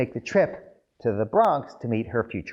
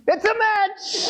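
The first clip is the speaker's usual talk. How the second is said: much higher, slow and beaming; delighted verdict; slower than usual. Retold or imitated. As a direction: announce it like a joyful matchmaker. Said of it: "She goes".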